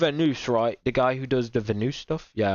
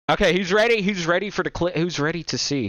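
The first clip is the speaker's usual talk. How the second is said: hype announcer; 6 semitones higher than usual. Pretend. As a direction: pump up the crowd. Calling out to viewers.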